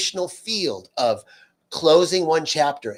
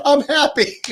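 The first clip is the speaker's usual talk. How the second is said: much higher, laughing through the words; 13 semitones higher, 8 dB louder than usual. Retold or imitated. Friend laughs off her ex-husband's death, laughing. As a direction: giggle it out, delighted. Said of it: "She goes".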